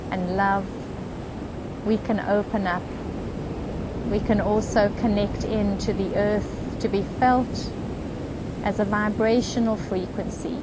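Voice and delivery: unhurried, even meditation voice with long pauses; guided meditation. Real, not acted. Meditation leader guiding a group on the beach into comfort, calm guided meditation. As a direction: lead them gently, phrase by phrase, leaving space.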